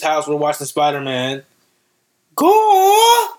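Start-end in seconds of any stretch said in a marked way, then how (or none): 2.3-3.3 very high, loud cheer; excited shout. Retold or imitated. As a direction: yell it like a kid cheering Spidey. Cheering Spider-Man on.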